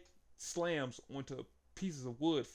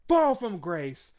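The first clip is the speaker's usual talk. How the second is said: louder, higher and slower on fall from grace; hammed-up finisher call, drawn out; mock callout; 4 semitones higher, 9 dB louder, slower than usual. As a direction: bellow the finisher name like a wrestler. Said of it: "He said".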